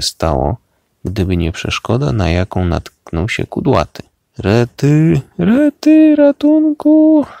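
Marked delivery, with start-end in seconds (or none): 4.4-7.3 very high, loud cartoon cry for help; panicked scream. A narrator's reading. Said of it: "krzyczał". (przerażony, piskliwy krzyk o pomoc)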